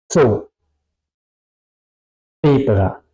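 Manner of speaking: "So", "Zebra" with hard TS onset; forceful German Z. Shown as a demonstration. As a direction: say "So", "Zebra" as "tso", "tsebra". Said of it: "mucha fuerza se produce ese sonido". ("So", "Zebra" con TS muy fuerte)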